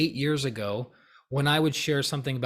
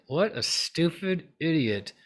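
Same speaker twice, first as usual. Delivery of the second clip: slow, drawn-out, higher mock sweetness; smiling contempt; 4 semitones higher, slower than usual. Thinking ill of someone while smiling, sweetly contemptuous. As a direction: insult them slowly through a smile. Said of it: "have a smile on your face".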